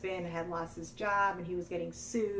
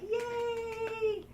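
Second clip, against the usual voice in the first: high squealed imitation of a gloating yay; mock cheer. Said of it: "And she was like".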